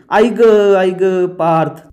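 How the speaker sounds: drawn-out mock wail, starting high and loud; hammed-up cry for help after a fall; acted slow, whining "ow"; crying out in pain. A narrator's reading. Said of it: "जोरात खाली पडते आणि ओरडू लागते".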